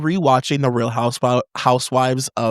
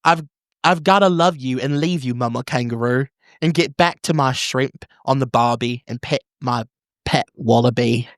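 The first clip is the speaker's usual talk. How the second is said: lilting, slightly higher theatrical Australian; broad Australian letter-reading voice; Australian accent; 3 semitones higher than usual. Acted. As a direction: ham up the letter in an Aussie accent. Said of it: "read this in an Australian accent".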